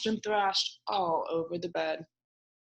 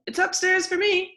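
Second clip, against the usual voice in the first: very high, loud happy yell; shouting; 11 semitones higher, 4 dB louder than usual. A narrator's reading. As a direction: yell it out with glee. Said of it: "yelled Ted".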